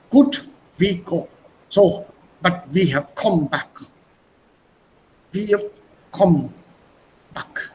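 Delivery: lightly German-accented English, slow and deliberate; a hint of a German accent, taken slowly. Shown as a demonstration. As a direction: read it like a German speaking English. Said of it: "You have to read it with a German accent".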